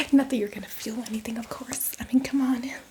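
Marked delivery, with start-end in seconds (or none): none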